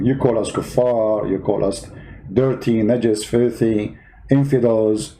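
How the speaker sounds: usual speaking voice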